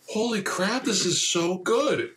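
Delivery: high, slowed mock amazement. Remembering first hearing the album, mock amazed. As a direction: marvel at the song. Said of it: "I was like".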